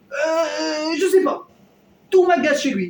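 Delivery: plaintif, plus aigu et plus fort; un râleur qui geint sans savoir pourquoi; râleur agacé. Acted. Acted complainer who cannot explain. (voix de râleur exaspéré, plus aiguë)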